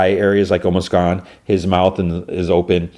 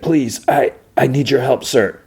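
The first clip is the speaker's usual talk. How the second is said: pleading hero; 6 semitones higher than usual. Retold or imitated. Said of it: "He's like".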